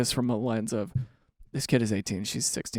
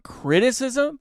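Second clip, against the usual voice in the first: incredulous; 9 semitones higher, much slower than usual. Real, not acted. Incredulous repeat of word.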